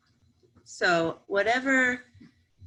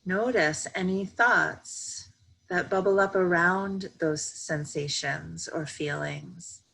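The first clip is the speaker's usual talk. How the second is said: slow, even meditation voice with long pauses; calm, measured yoga nidra guidance; guided meditation. Real, not acted. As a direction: guide them gently into stillness, unhurried.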